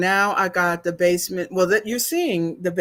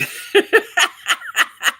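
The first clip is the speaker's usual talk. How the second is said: high, breathy burst of teary laughter; rapid high cackle through tears; tearful laugh; 13 semitones higher, 4 dB louder than usual. Real, not acted. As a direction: laugh while crying. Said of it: "I'm crying again".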